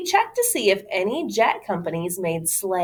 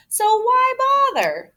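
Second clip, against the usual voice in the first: much higher mocking boy voice; scoffing boy; 5 dB louder than usual. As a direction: sneer it like a know-it-all kid. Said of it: "Alfie said".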